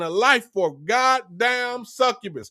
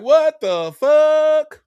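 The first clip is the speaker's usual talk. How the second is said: slow, higher, stretched out; drawn-out disbelief; 7 semitones higher, 7 dB louder, much slower than usual. Retold or imitated. Dumped man staring at phone, slow stunned disbelief. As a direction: let the disbelief hang, slowly. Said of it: "you like".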